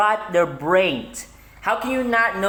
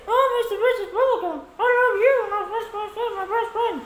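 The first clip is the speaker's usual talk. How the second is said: cartoon princess. Gushing to an imaginary toy friend.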